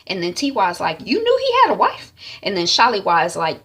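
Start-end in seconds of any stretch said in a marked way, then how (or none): 1.0-2.1 high, loud incredulous question; shocked accusation. Retold or imitated. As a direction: confront her in acted disbelief. Sister confronts sister over affair.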